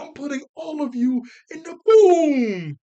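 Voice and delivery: high, very loud, slow hammed-up shout; mock villain yell. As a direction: bellow the threat like a cartoon villain. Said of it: "She was like".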